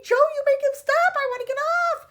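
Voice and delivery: very high, loud screeching grandma; panicked yell. A narrator's reading. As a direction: screech to be let off. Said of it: "yelled Grandma Josephine".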